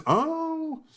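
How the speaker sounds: high, soft, drawn-out mock reaction; Jay Leno. Retelling the host just listening and learning, high soft imitation. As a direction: imitate a host softly taking it in. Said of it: "He says".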